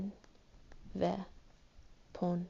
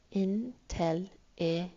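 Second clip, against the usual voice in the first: Inteligente stretched out slowly, syllable by syllable; much slower than usual. Shown as a demonstration. Teacher to class, counting the syllables slowly. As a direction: slowly spell out the sounds of Inteligente. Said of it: "One, two, three".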